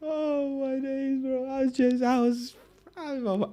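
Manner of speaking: high, squeaky words through laughter; helpless laughter. Real, not acted. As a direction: barely get the words out for laughing. Cracking up at his own impression.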